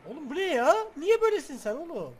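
loud, high yell at the screen; exasperated yell. Real, not acted. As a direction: shout in disbelief. Gamer yelling at game glitch.

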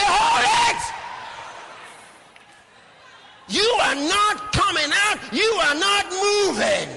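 very high, loud rebuke; preacher demonstrating a forceful prayer command; commanding shout. Shown as a demonstration. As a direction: order it to stop at full force.

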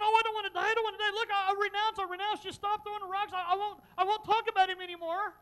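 loud, high hammed-up pleading; mock crying. Preacher showing how Stephen did not beg, mock-crying for his life. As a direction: plead frantically in mock terror. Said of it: "He wasn't crying, going".

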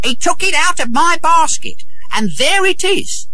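very high-pitched woman's voice; high, scolding complaint; accusing woman. Acted. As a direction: accuse him in a high, insistent voice. Robbed woman accuses the boy.

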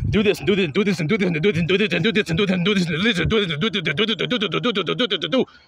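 mocking rattle. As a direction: rattle off do this mockingly, like an endless list. Mocking endless commandments list.

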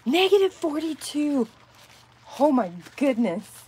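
can't believe the cold; high astonished exclamation. Real, not acted. Reading a viewer's temperature.

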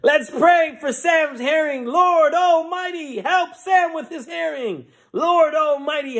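very high, drawn-out revival shout; preacher leading prayer. Acted. Preacher rallies crowd for Sam's hearing, high shouted prayer. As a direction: wail the plea out to the whole room. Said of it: "the preacher looks at the crowd".

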